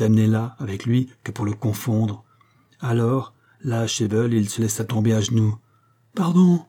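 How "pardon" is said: higher, louder panicked plea; a terrified man begging on his knees; panicked plea for mercy; stammering, panicked. A narrator's reading. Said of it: "balbutia-t-il, affolé".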